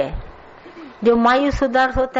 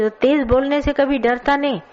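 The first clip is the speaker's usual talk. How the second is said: ordinary talk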